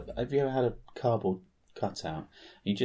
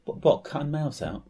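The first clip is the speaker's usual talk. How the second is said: ordinary delivery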